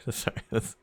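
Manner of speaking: cracking up at the end; laughing at own joke. Real, not acted. Streamer amused by own British joke, dissolving into laughter. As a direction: break into quiet giggles while apologizing. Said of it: "I don't know why I'm laughing".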